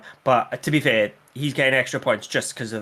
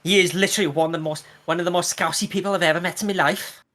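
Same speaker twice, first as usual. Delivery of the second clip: attempted Scouse accent; 6 semitones higher, 3 dB louder than usual. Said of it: "let's not do that again".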